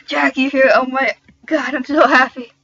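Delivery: loud, excited greeting; happy scream. Acted. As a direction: exclaim with joyful surprise. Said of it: "screamed happily".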